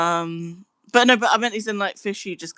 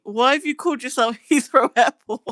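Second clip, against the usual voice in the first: higher, words breaking into laughter; laughing while talking; 7 semitones higher, 4 dB louder than usual. Real, not acted. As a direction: ask through real giggles. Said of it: "I can't stop laughing at it".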